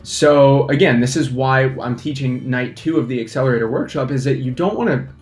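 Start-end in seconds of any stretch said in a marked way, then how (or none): none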